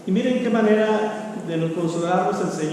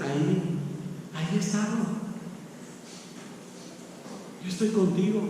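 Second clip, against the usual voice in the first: hushed voice of God; 2 semitones lower, 11 dB quieter than usual. Acted. God answers softly.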